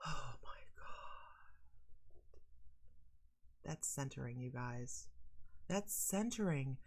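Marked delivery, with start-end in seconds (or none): 0.1-2.4 breathy whispered oh my God; slow relieved whisper; whispered sigh of relief. Retold or imitated. Sitting down in alone time.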